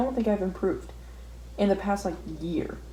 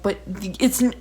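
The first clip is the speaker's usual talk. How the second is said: frustrated protest; 8 semitones higher, 7 dB louder than usual. Retold or imitated. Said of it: "And I'm like".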